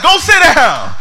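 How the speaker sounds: very high, loud barked command; dad's order. Retold or imitated. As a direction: snap at the kids to sit down. Dad ordering siblings away.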